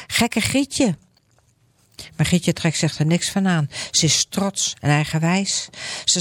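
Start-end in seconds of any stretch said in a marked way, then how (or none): 0.1-1.0 higher, teasing taunt; mocking crowd. People calling Grietje names in a higher taunt. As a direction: jeer at her like a crowd of bullies. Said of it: "Ze roepen".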